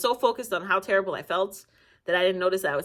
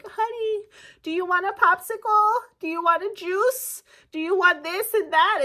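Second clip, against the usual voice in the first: put-on much higher, sugary old lady doctor; sweet old lady doctor; 11 semitones higher, 4 dB louder than usual.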